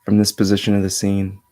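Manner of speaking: measured meditation voice with long pauses; steady, level tone, resting between phrases; guided meditation. Acted. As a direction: guide them inward in an even, unhurried flow. Leads a meditation.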